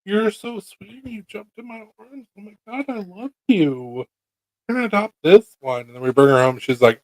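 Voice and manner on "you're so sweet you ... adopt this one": put-on high gushing baby-talk voice; high cooing voice for a new pet; baby talk to a kitten. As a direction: gush over the kitten. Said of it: "And I was like".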